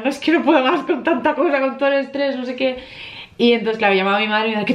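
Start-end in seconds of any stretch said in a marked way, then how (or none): 0.0-2.8 higher, breaking, tearful voice; tearful venting. Retold or imitated. Telling viewers how she cried, imitating her tearful self. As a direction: relive the tearful overwhelm, lightly imitated. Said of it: "me he puesto ahí a llorar como una madalena".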